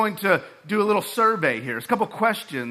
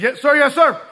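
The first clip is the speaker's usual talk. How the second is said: higher, louder, laughing yes sir; eager yes sir; 7 semitones higher, 6 dB louder than usual. Retold or imitated. Cadet answering squad leader, eager and laughing. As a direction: snap to attention with a laugh.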